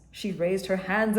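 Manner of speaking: plain reading voice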